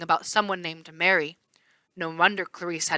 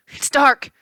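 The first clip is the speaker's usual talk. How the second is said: higher, louder frightened yell; 8 semitones higher, 8 dB louder than usual. A narrator's reading. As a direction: yell it into the dark. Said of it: "Chris yelled".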